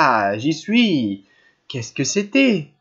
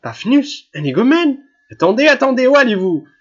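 higher and louder, anxious insistence; exclaiming, vexed; 8 semitones higher, 6 dB louder than usual. A narrator's reading. Wife calling her departing husband back, high and insistent. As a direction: call after him before he escapes out the door. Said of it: "s'exclama la générale ... inquiète et dépitée".

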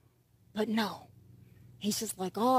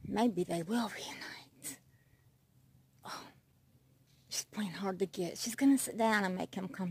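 whisper; 4 semitones lower, 6 dB quieter than usual. Real, not acted. Narrating the cats' courtship in a hushed whisper. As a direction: hush it like a nature show.

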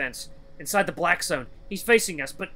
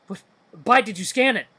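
higher, louder accusing question; agitated demand; 7 semitones higher, 8 dB louder than usual. Acted. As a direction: demand an explanation after the scare. Angry demand after danger.

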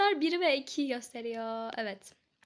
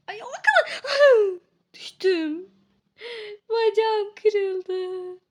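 mock fall; 6 semitones higher, 9 dB louder, much slower than usual. Falls dramatically.

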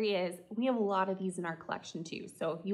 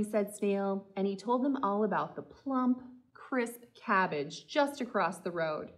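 ordinary delivery, nothing marked

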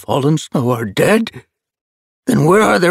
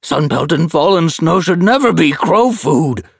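higher, louder indignant elder; protesting elder; 11 semitones higher, 7 dB louder than usual. A narrator's reading. Elder protests to the leader, loud and indignant. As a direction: demand honour for the dead. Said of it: "Oakstep protested".

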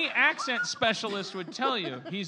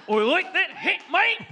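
high, loud broad Australian outburst; exaggerated Australian accent; 10 semitones higher, 7 dB louder than usual. Shown as a demonstration. Comic proving his accent to laughing friends, belted Australian accent. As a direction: shout it in overblown Australian. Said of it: "genuinely a true Australian accent".

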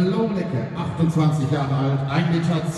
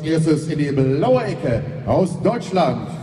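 boxing ring announcer; 4 semitones higher, 3 dB louder than usual. Acted. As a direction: stretch the names like a ring announcer. Ring introduction of fighters. (Ringsprecher, der die Namen langzieht)

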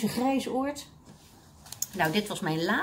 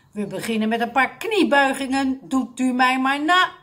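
loud, raised commanding address; megaphone loudness; 4 semitones higher, 6 dB louder than usual. A narrator's reading. Dictator addressing seniors through a megaphone, loud. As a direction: call out to the whole hall. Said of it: "Het klonk zo hard".

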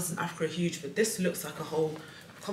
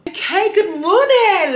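high, loud, drawn-out good morning; big morning greeting; 13 semitones higher, 15 dB louder, slower than usual. Pretend. Vlogger restarting her intro with a loud, slow, high greeting. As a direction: greet the camera like a hammed-up presenter. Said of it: "Let's try that again".

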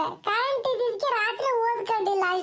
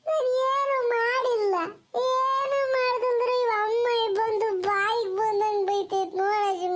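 slow, wailing tearful complaint; acted crying. Crying boy complains to grandmother about mother, slow wail. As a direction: bawl out your innocence in long dragged words. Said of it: "ಅಳ್ಬೇಡ ಸುಮ್ಕಿರಪ್ಪ".

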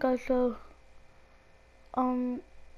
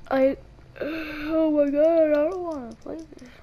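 frustrated groan; 4 semitones higher, 4 dB louder than usual. Real, not acted. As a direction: sigh and whine it out in exasperation. Rage at hard parkour level.

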